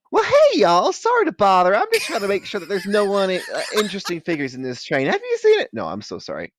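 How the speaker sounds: high, loud, chirpy y'all voice; friendly southern dementor. Acted. The dementor boarding the train, chirpy and high. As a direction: play the dementor as a cheerful neighbor.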